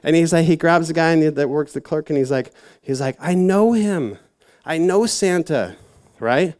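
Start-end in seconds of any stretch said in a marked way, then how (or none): 3.2-5.8 high, eager elf voice; lightly voiced eager elf; excited elf. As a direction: gush with delight like the elf. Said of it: "he got super excited".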